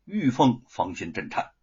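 plain reading voice

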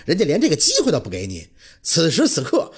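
usual speaking voice

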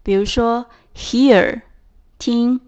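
ordinary talk

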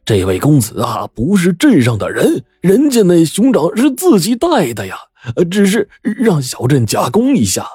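high-pitched, stammering, placating voice; obsequious innkeeper. A narrator's reading. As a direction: play a fawning boss, squeaky and apologetic. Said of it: "老板陪笑道".

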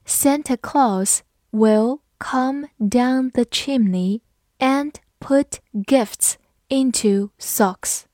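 very slow, careful reading; slow read-through. Shown as a demonstration. Teacher reading the Santa sentence slowly for learners. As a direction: spell out every word slowly. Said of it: "好，我们慢慢来读".

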